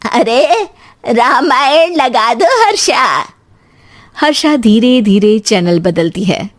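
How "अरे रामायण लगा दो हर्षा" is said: put-on slow, drawn-out elderly request; stretched-out mother-in-law voice. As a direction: call out slowly to the daughter-in-law. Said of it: "तभी सास कहती है".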